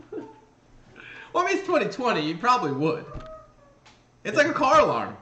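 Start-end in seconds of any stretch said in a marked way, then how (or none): none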